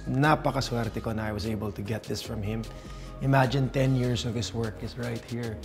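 ordinary talk, nothing marked

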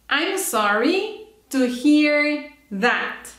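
slow, drawn-out model for repeating; careful slow teacher demo; slow model phrase. Shown as a demonstration. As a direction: stretch each word of "I'm sorry to hear that" so students can copy. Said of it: "Listen and repeat".

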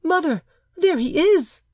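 put-on very high, excited child's shout; crying child. A narrator's reading. Child points out the boy in the street, crying out. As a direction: call out high as a little boy. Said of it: "he cried".

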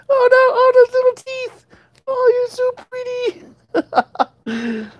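high, sing-song pet voice; baby talk to cats. Acted. Owner cooing to her cats, high baby talk. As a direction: coo at the cat like a baby.

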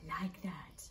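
whispered approval. Real, not acted. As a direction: say it under your breath. Unvoiced aside.

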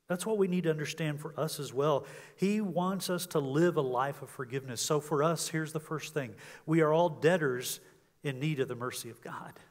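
ordinary talk, nothing marked

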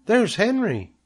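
put-on very high little girl voice; excited cry. A narrator's reading. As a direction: call out on spotting a friend. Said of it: "cried Fern, pointing".

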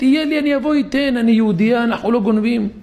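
high-pitched retold begging; urgent imitated plea, pitched well up; desperate pleading. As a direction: act out a woman begging for mercy. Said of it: "מתחננת".

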